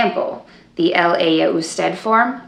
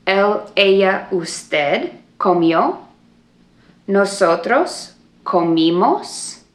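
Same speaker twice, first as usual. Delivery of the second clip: slow drill for repeating; slower than usual. Shown as a demonstration. Teacher leading a repeat-after-me drill, slow and clear. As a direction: spell out each form for the class to echo. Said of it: "Let's do it one more time".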